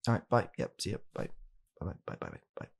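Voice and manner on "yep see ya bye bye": ordinary delivery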